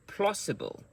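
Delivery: Plausible with PLAU pitched high, then falling; word stress demo. Shown as a demonstration. Teacher drills Plausible for learners, stressed on high PLAU. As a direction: hit the first syllable of Plausible high. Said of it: "those sounds are the strong sounds".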